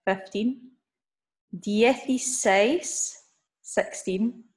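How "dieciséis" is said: slow, drawn-out "Dieciséis", rising at the end; modelled for repetition. Shown as a demonstration. Teacher modelling a number for learners to repeat, slow and deliberate. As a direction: stretch out "Dieciséis" with a lifted ending. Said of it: "after me".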